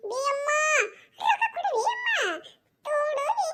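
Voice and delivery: put-on high childish begging voice; whiny little kid. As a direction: plead like a small boy wanting sweets. Grandchild voiced asking for sweets. (ಚಿಕ್ಕ ಹುಡುಗನ ಗೋಗರೆಯುವ ಧ್ವನಿ)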